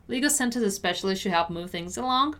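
brisk, connected read; fast model sentence for learners. Shown as a demonstration. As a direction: say it at natural speed in one flow. Teacher repeats at quicker pace.

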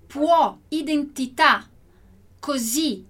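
può, identità, così with a wide open, stressed last vowel; teacher showing the grave accent's open sound; open final vowel. Shown as a demonstration. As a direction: lean on the accented final syllable. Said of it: "O som dessas últimas letrinhas aí é bem aberto".

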